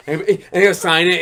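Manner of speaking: ordinary talk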